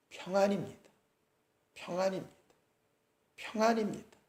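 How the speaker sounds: soft, restrained repeats, fighting tears; mother forcing out her answer through gritted teeth, quietly; held-back answer. Acted. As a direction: barely say it, jaw tight, holding it together. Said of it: "이빨을 악물고 평안하다고 고백을 합니다".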